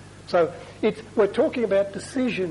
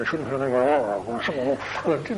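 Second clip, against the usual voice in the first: slow, strained, drawn-out mock growl; anger bursting out; 3 semitones lower, much slower than usual. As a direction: act repressed anger swelling into a growl. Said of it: "it'll end up being that high".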